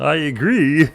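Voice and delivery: high, loud Kermit-like croak; comically strained high voice; strange funny voice. Acted. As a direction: agree in a silly puppet voice. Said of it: "What happened to your voice?".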